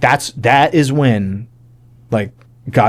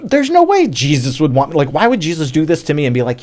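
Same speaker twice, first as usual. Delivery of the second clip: high-pitched, emphatic retold objection; incredulous protest; 6 semitones higher, 3 dB louder than usual. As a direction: push back hard at the pastor. Acted protest to the pastor.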